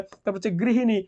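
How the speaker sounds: ordinary delivery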